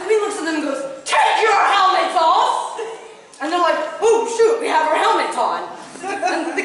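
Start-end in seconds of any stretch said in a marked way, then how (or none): 1.0-2.8 high, loud, slow order through laughter; mock royal order. Queen ordering knights. 4.0-5.7 loud, laughing oops; mock sheepish realisation. Knights realising mistake.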